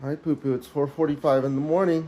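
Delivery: usual speaking voice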